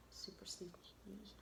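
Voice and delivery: hushed secret whisper on "Super sneaky E"; sneaky stage whisper; playful whisper. Shown as a demonstration. Teacher whispers silent E playfully.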